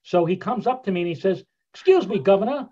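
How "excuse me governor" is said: mock British gent, pitched up a little; a hint of a polite Englishman on a plane; lightly imitated British traveller; British accent. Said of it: "they really do talk like that".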